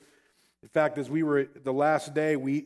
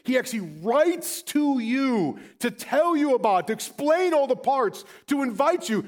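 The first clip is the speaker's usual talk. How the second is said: high, driving sermon voice; impassioned preaching; 10 semitones higher, 5 dB louder than usual. Real, not acted. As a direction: preach with full force, stretching the key words. Preaches at full force.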